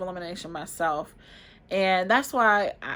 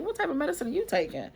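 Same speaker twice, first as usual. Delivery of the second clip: much higher, a surprised friend's question; curious friend; 8 semitones higher, faster than usual. Retold or imitated. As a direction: pitch up as a nosy friend spotting pills. Friend asking about the pills.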